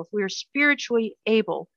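ordinary talk

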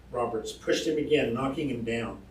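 ordinary delivery, nothing marked

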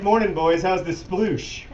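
high, bright folksy greeting, slowed; cheerful old-timer greeting. Pretend. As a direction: hail the boys like a chipper old man. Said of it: "if someone is like".

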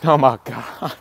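usual speaking voice

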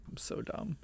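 unvoiced, breathy self-scold; under-breath self-mockery; whispered aside. Real, not acted. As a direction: whisper it to yourself. Muttered self remark.

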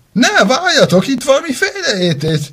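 high, loud protest; pleading panic. Acted. Accused girl begs the children to wait, high and loud.

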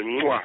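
demonstrated "mwah"; kiss sound. Shown as a demonstration. Showing his wife the chirped kiss, quick smack. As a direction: re-enact a quick phone kiss. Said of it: "You don't be hearing the kisses?".

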